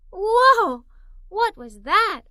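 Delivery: a sudden high, loud "Whoa!" then a startled question; a kid caught off guard by a rush of wind; high, loud startled child; startled gasp. A narrator's reading. Child startled by runners rushing past.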